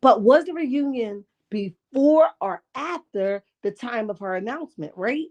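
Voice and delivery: ordinary talk, nothing marked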